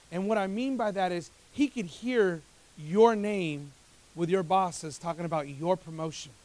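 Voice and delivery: ordinary talk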